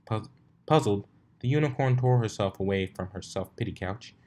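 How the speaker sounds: ordinary delivery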